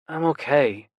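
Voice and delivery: plain reading voice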